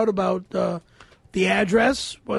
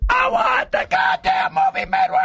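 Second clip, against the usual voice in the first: shouted mock tantrum, much higher; mock outburst; 3 dB louder than usual. Demanding the movie go back to Chicago, shouting. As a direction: yell it like a diva walking off set.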